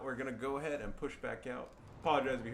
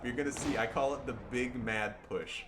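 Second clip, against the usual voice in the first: normal talking voice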